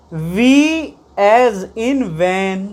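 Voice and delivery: "V as in van" drawn out very slowly; slow pronunciation demo. Shown as a demonstration. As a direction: drag out "V as in van" for the class. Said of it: "ऐसे पहचानना हमें".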